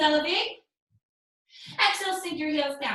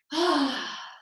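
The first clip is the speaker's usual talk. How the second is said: long falling exhale; recovering sigh. Real, not acted. Teacher catching their breath after the flow, long sigh. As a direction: sigh it all out.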